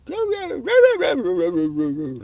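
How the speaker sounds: very high, loud howling roo roo like a dog; dog talking voice. Retold or imitated. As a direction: yowl like a dog trying to talk. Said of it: "whatever kind of dog voice it has".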